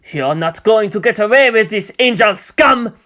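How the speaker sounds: loud, furious outburst at the villain; angry shout. A narrator's reading. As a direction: hurl it across the table. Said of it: "Martin shouted".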